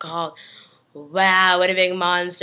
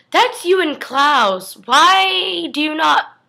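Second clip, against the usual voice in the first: slow stunned disbelief; 8 semitones higher, 6 dB louder, much slower than usual. Acted. Reacting to photo of Stefan and Klaus.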